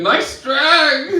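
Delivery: high, loud holler over club music; shouted compliment. Retold or imitated. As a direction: yell it at someone in a noisy bar. Stranger repeats his yelled compliment.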